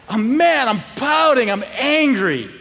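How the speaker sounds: hammed-up list of grievances; mock angry. Boy banned from the party, mock mad and pouting. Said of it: "you get more and more angry".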